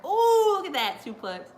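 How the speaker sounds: sudden high laughing oh; excited gasp. Real, not acted. Watching the suplex live, a loud laughing oh. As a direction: react loud to the big move.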